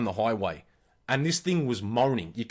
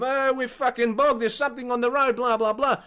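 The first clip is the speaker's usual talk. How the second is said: high, accented mock excuse-making; raised-pitch stepdad impression; stepdad explaining himself; 8 semitones higher than usual. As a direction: imitate a drunk Hungarian fobbing someone off. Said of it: "John's trying to explain to him".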